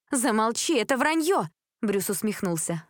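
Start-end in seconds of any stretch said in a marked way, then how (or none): 0.1-1.5 high, indignant outburst; angry denial. A narrator's reading. Elizabeth silencing gossip about her father, angry. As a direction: cut him off with rising pitch.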